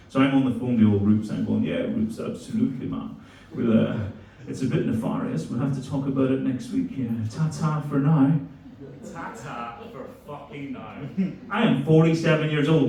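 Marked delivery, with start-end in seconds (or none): 1.6-8.3 put-on posh accent. Speaker on the phone to posh mate Rupert, in a mild posh accent. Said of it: "as fucking posh as he sounds". 9.1-10.9 disbelieving mutter. Real, not acted. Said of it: "I said that shit out loud".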